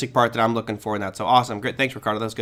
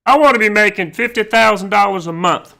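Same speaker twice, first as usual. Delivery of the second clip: higher, louder put-on student voice; eager student Bob; 6 semitones higher, 6 dB louder than usual. Instructor acting out a student announcing his expectations, loud put-on voice. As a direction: play a student loudly declaring a big goal.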